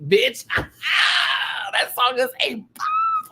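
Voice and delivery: high, loud squeal stretched on the last word; gushing excitement. Real, not acted. Fan raving about a favourite song, squealing with excitement. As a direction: shriek it out like a thrilled fan.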